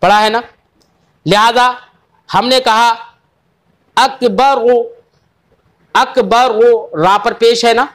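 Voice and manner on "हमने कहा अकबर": usual speaking voice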